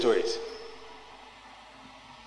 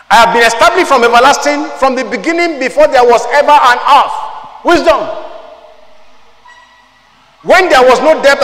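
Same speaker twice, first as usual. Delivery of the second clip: higher, loud preached Bible reading; fervent scripture reading; 4 semitones higher, 6 dB louder than usual. Acted. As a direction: proclaim the verses to the congregation.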